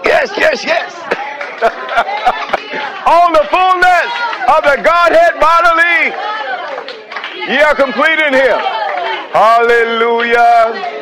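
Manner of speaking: soaring shouted praise; preaching at full force. Real, not acted.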